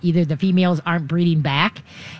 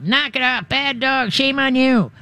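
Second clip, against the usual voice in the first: high mock scolding of a dog; 7 semitones higher than usual. Owner scolding a stressed dog, mock scolding. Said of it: "start screaming at 'em".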